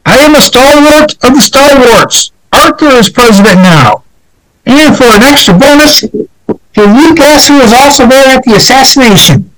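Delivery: high, excited declaration; Guiteau quote. Retold or imitated. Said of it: "Guiteau said".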